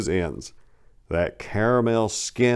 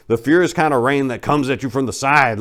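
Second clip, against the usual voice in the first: ordinary delivery, nothing marked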